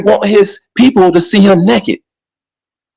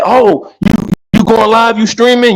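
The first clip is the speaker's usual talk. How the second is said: put-on light Kenyan accent, a bit higher; hint of a Kenyan woman's accent; 4 semitones higher than usual. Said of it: "her African voice and her Kenyan voice".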